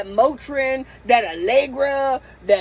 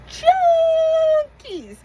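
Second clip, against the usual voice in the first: crying; 3 dB louder than usual. Real, not acted. Crying over her parents.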